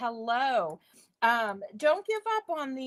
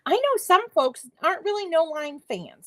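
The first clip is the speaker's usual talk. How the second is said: ordinary talk, nothing marked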